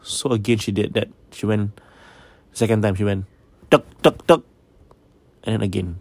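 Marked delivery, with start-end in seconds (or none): 3.7-4.4 sharp, high, loud tuk tuk tuk; loud pole knocks. Retold or imitated. Woman banging her ceiling with a pole, loud sharp tuk tuk tuk.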